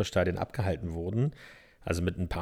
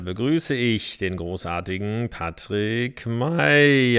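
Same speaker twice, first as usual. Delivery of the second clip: slow, louder hype introduction; lucha libre announcer; 7 dB louder, slower than usual. Acted. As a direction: announce him like a champion fighter. Hypes up co-host like fighter.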